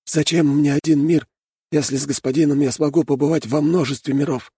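high, calm rat voice; parry without a flicker of emotion; unruffled retort. A narrator's reading. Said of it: "невозмутимо парировал Один".

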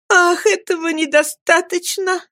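put-on high, tearful girl's cry; a young girl's high, upset exclamation. A narrator's reading. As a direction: cry out in distress. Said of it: "закричала Эми".

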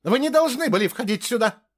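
high-pitched squealed protest; indignant shriek. A narrator's reading. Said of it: "завизжала Ингрид". (визгливое возмущение)

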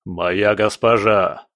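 low, slow robot boom; mechanical booming voice. A narrator's reading. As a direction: boom it like a loyal golem. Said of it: "прогудел он механическим голосом".

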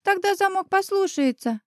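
put-on high child's voice, helpfully prompting; prompting little boy. A narrator's reading. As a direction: pitch it up like a small boy coaching a grown-up. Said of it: "подсказал Ваня". (высоким детским голоском, подсказывая)

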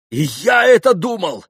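high, loud exclamation; shouted. A narrator's reading. Mitya agrees with Grushenka, shouting. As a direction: cry out your agreement. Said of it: "крикнул Митя".

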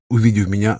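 ordinary delivery

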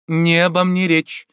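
high, slow, firm retort; emphatic, measured refusal; resolute objection. A narrator's reading. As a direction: object decisively, drawing it out. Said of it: "решительно возразила Адриенна".

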